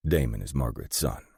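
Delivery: ordinary delivery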